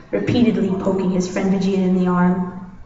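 plain reading voice

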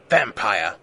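very low, ominous name drop; low, portentous announcement; dramatic reveal. A narrator's reading.

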